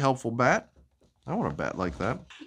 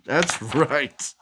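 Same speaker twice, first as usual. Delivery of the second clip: ordinary delivery, nothing marked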